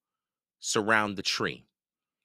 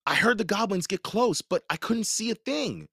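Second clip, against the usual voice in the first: put-on much higher girl's voice; scared girl; 13 semitones higher than usual. A narrator's reading. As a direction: tell it shaken. Blinded girl reports fear.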